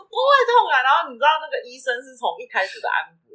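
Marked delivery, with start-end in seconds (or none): 0.1-0.8 高很多地模仿奶奶哄孩子; 学奶奶哄哭闹的孙子; grandma coaxing. Retold or imitated. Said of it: "然后她奶奶就说". (模仿奶奶哄孩子)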